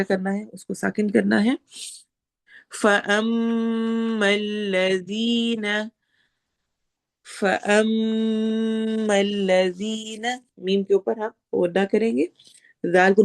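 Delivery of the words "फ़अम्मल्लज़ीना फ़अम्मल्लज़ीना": slow chanted recitation with doubled consonants held; Quranic recitation. Shown as a demonstration. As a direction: chant it slowly, holding every shadda. Teacher recites Quranic verse.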